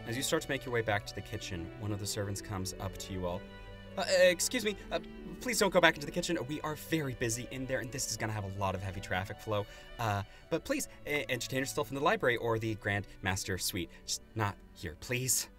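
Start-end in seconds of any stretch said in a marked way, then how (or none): 4.0-15.5 flustered servant. Acted. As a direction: voice a harried servant shooing guests out.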